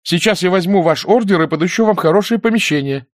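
put-on high-pitched young woman insisting; urgent insistence. A narrator's reading. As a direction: talk them out of it, pitched well up. Nina urgently bargains with soldiers. (взволнованно, настойчиво)

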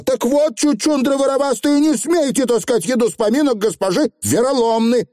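высоко и резко отчитай; строгий окрик на воришек, высоким голосом; сердитый рявк. A narrator's reading. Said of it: "сложила руки на груди и рявкнула". (рявкнуть)

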